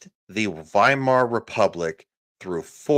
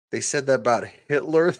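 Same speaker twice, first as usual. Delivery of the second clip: quick, higher disbelieving question; incredulous echo; 4 semitones higher, faster than usual. Real, not acted. Streamer answering a chat comment, incredulous echo. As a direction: throw it back as a question.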